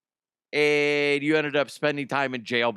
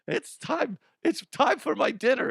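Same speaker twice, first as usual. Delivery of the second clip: put-on old lady voice; 10 semitones higher than usual. Old lady heading to early dinner, high mock elderly voice. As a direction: play a little old lady due for dinner. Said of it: "this old lady, and she's like".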